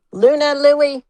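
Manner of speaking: shouting for the dogs outside; calling out. Real, not acted. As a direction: call the dogs from across the yard.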